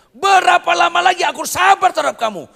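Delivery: very high, loud furious outcry; furious shout. Acted. Preacher voicing Jesus's furious loss of patience, shouted high. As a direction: roar it with lost patience. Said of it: "Itu marah besar".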